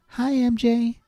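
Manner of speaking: put-on high, tiny child's greeting; little kid voice. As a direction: say it like a small child at the door. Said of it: "you hear a little voice".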